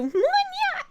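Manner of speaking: high squeak broken by a hiccup; very high, aborted cry; failed scream. Real, not acted. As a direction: try to scream, cut short by a hiccup. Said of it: "I couldn't scream it 'cause I hiccup".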